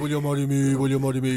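low, chesty proposal at full voice; low demo voice, not hushed; deep proposal. Shown as a demonstration. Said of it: "number one is go deep".

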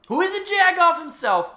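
loud high outburst; yelling. Real, not acted. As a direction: blurt it out loud. Ranting at Eric Trump.

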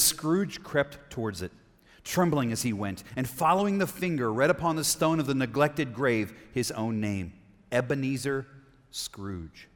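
slow, suspenseful reading with pauses; story narration. A narrator's reading. Preacher reading the graveyard scene aloud, suspenseful narration.

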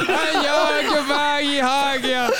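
high, laughing uncanny greeting; laughing creature voice. Pretend. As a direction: mimic a creature through laughter. Skinwalker voice, laughing.

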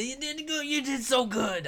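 high, praising mother voice; proud mother voice. Acted. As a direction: praise them like a proud mom.

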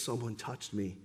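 quiet insistence; insistent Jesus, hushed. Acted. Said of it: "Jesus insists".

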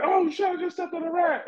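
retelling stepping on a rat, high shocked squeal; startled yelp. Retold or imitated. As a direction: squeak it out like you just stepped on something. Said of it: "And I was like".

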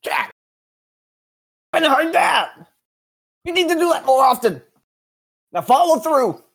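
high, strained bowling coach holler; hammed-up impression of a hollering coach; Mister Parker. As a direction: bellow coaching orders in a high voice. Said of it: "let me do my best Mister Parker impression".

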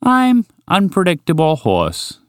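high, slow, self-important introduction; grand announcement. Acted. Horse introducing himself to impress them, grand announcement. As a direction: announce your name slowly, meaning to impress. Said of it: "he finally announced".

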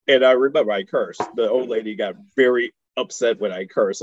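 usual speaking voice

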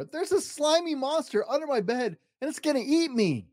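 high frightened cub voice; a terrified little cub pleading; scared little bear. Acted. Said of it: "squealed Little Bear".

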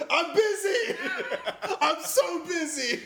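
high, laughing mock exasperation; mock busy. Bartender brushing off the server, high laughing whine. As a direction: whine that you're swamped, laughing. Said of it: "And I'm like".

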